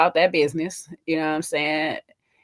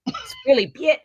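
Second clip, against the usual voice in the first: ordinary talk, nothing marked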